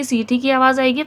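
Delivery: ordinary delivery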